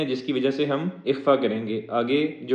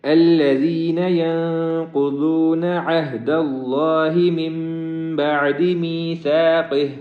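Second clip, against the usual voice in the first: slow melodic Quran chant; chanted Quranic recitation, long and melodic; tajweed recitation; 3 semitones higher, 5 dB louder, much slower than usual. Shown as a demonstration. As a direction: draw each word out in sung recitation. Recitation before translation.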